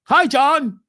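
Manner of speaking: mock the fake-intelligent work voice; mimicking a coworker's stiff, lipless greeting; stiff office voice. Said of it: "act like you don't have any lips".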